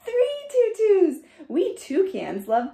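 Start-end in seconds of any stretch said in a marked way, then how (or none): none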